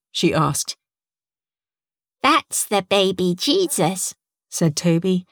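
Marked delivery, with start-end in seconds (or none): 2.2-4.2 put-on a proud little boy explaining. A narrator's reading. Toby the child speaks.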